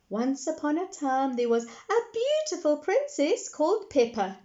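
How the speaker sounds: put-on high eager little girl. A narrator's reading. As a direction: cut in like a small girl. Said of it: "interrupted Peppa".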